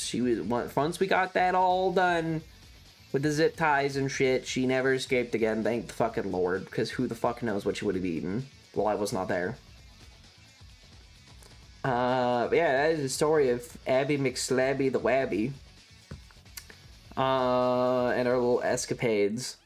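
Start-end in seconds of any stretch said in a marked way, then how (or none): none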